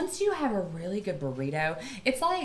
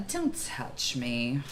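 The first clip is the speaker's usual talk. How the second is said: slow stern warning; 7 semitones lower, 6 dB quieter, much slower than usual. Real, not acted. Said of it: "But, uh".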